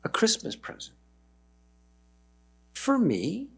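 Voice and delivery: hushed, breathless disbelief; awed whisper. Acted. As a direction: whisper it in amazement. Maid astonished by gift.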